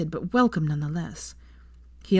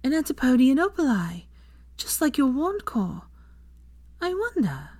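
higher, bright encouraging woman; Hermione's voice; 8 semitones higher than usual. A narrator's reading. Narrator voicing Hermione encouraging Draco. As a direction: voice Hermione cheering him on.

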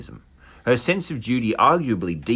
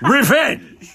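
dramatic shout; 8 dB louder than usual. Acted. Dramatic reveal while reading story.

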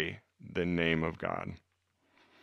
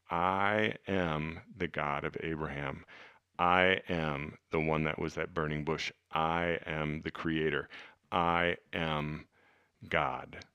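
hushed, slow and low, each phrase weighted; low, soft, measured proclamation; solemn divine declaration; 4 semitones lower, 9 dB quieter than usual. Acted. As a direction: speak as Jesus, softly and deliberately claiming to be God. Voicing Jesus declaring himself God.

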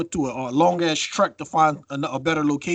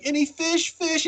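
much higher, pleading mock beggar; begging voice; 12 semitones higher, slower than usual. Contestant begging the fisherman for fish, high whiny plea. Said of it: "she's like".

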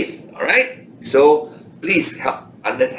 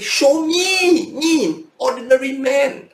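voicing James. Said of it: "So James is saying".

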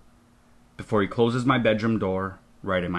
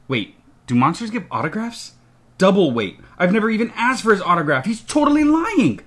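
indignant disbelief; 10 semitones higher, 4 dB louder than usual. A narrator's reading. Child realises the visitor is lying, with rising indignant disbelief. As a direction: voice climbing ever higher and louder.

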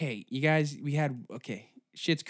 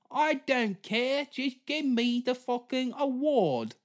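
a greedy star choosing her next queen; mock actress; 11 semitones higher than usual. Voices Colman demanding.

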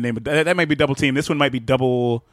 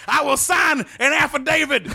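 wrestling commentator; 12 semitones higher than usual. Pretend. Jim Ross bit continues.

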